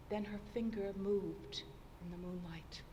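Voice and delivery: unvoiced, hushed whisper. A narrator's reading. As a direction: breathe it out in dread. Fatal moment, breath held.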